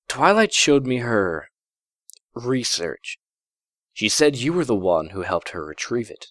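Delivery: calm and kind, easing him in; a hint of a gentle princess; warm and gentle. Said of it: "Her voice was warm and gentle".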